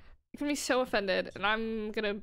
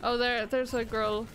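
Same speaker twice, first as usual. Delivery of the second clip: ordinary talk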